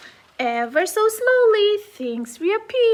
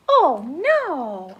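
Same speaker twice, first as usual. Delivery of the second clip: ordinary delivery, nothing marked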